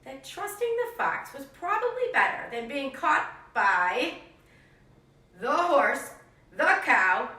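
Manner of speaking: put-on lively read-aloud for young kids; animated picture-book narration; storybook reading. A narrator's reading. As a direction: tell it like a classroom story time. Teacher reads story to class.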